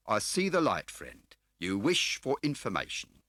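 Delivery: smarmy dealer playing grand; oily fat car dealer. A narrator's reading. Scar's voice held.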